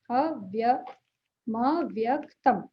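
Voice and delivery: अव्यक्तम drawn out very slowly with a clear opening अ; slow careful pronunciation. Shown as a demonstration. Teacher slowly sounding out अव्यक्तम for students. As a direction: stretch अव्यक्तम out, every sound held.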